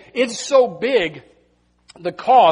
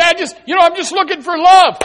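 raised pitch, a touch pleading; young seeker; 10 semitones higher, 10 dB louder, faster than usual. Retold or imitated. Preacher mimics young people searching for love, lightly pleading. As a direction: mimic a wistful young person looking for love. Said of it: "You say".

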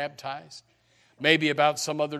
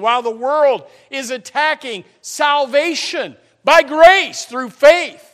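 fervent preaching; 10 semitones higher, 15 dB louder than usual. Acted. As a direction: preach hard against the world. Preaching at full force.

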